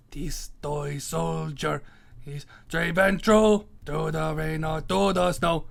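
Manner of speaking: put-on hammy, slow Italian accent. Ranker reciting about the toy soldier, mock Italian accent. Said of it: "I was doing an Italian accent".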